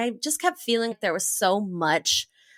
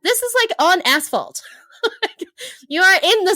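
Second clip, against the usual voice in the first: laughing; 9 semitones higher, 4 dB louder than usual. Real, not acted. Laughing at the absurd asphalt jump.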